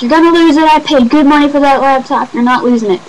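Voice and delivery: high, loud scolding; big sister scolding loudly; bossy older sister. Retold or imitated. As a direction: nag the little sister about the laptop. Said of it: "my sister was all like".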